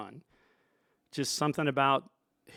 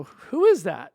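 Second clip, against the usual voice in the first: louder, higher acted curiosity; retold intrigued question; 5 semitones higher, 10 dB louder, slower than usual. Sound man asks Dave about stranger, intrigued. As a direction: imitate yourself demanding to know.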